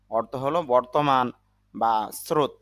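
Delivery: normal talking voice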